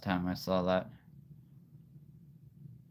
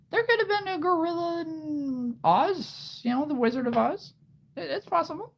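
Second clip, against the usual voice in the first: playful musing. Acted. Playful speculation in high voice.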